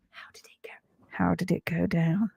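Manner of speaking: unvoiced, breathy aside under the breath; whisper. Real, not acted. Whispering to the viewers about the vet visit. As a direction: drop to a secret whisper.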